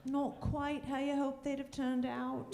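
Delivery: a hint of a prim British Bake Off judge; mock British judge. Wife mimicking her English husband in a light British accent.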